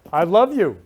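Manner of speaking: loud, heartfelt I love you; loud declaration. Real, not acted. Telling the viewers loudly she loves them. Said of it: "you don't even know me".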